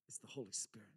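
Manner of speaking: unvoiced reverent whisper; hushed whisper of awe. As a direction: breathe it out as a secret. Reverent reveal of the Spirit.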